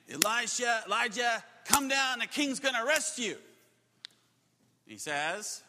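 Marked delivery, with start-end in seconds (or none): 0.1-3.4 loud, higher-pitched command; shouted demand. Acted. Soldiers demand that Elijah come down, shouted demand. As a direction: call out an order to surrender. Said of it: "They demand".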